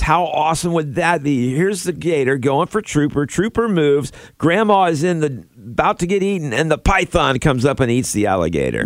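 excited play-by-play call; sports announcer. Acted. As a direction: call it like a live game. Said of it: "You almost sound like a sports announcer right now".

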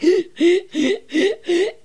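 sharp high gasp, half laugh half cry; panicked gasp. Retold or imitated. Frozen by the bus stop, a sharp high panicked gasp.

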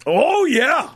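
high, whooping sports-fan cheer; excited high-pitched hollering. Real, not acted. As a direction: crow it out like a confident fan. Cheering for a team sweep.

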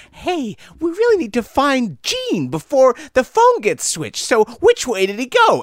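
high, slow villain voice; evil boss voice. Acted. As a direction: ham up a cartoon villainess. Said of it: "the big evil boss, and she's like".

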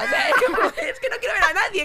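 high, loud laughing protest; laughing exasperation. Real, not acted. Mother telling friends about visitors, laughing and high. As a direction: laugh through the complaint. Retelling ward full of visitors.

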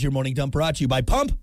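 ordinary talk, nothing marked

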